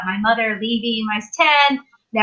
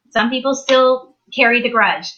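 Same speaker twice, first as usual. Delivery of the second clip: ordinary delivery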